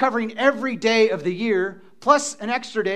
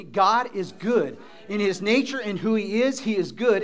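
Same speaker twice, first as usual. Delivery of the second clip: usual speaking voice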